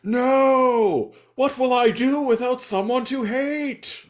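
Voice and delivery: high, loud drawn-out melodramatic cry; hammed-up villain wail. Kurgan Viking loses his enemy in the explosion, melodramatic wail. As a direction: wail it far above your normal pitch. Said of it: "like, quite hilariously".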